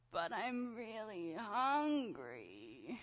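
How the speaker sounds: put-on slow, drawn-out whiny kid voice; little son's voice. Son pleading to mom for lunch, drawn-out whine. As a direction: plead like a hungry three-year-old. Son answers asking for food.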